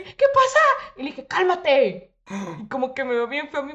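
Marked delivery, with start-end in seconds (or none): 0.0-0.9 very high, loud, slow panicked cry; panicked mother. Retold or imitated. Mother finds her daughter crying at the salon, panicked. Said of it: "súper asustada". 1.3-2.1 snapped order. Retold or imitated. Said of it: "No me grites".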